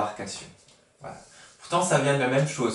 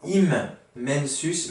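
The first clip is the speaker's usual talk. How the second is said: Immensus stretched out very slowly with a long double m; slow careful Latin; much slower than usual. Shown as a demonstration. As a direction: teach the Latin word slowly to children. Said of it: "En latin, on dit immensus".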